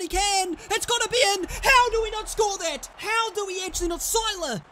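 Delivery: loud, high yell at the near goal; excited shout. Real, not acted. Gamer screaming at a missed sitter, high shout. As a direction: yell it, sure the ball goes in.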